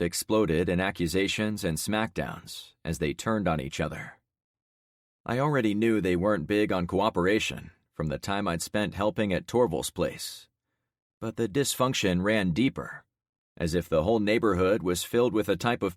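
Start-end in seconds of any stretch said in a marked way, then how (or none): none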